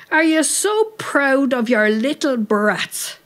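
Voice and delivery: high, drawn-out challenge; indignant shout. A narrator's reading. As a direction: call up scornfully, stretching the words. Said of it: "shouted up at one of the windows".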